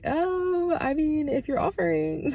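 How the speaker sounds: high-pitched, playfully bashful reply; coy acceptance. Retold or imitated. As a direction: act pleasantly surprised, coyly taking up the offer. Retells her coy acceptance of invitation.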